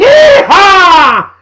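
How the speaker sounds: high, loud laughing yeehaw; cowboy yeehaw whoop. Acted. Cowboy whoop to start show.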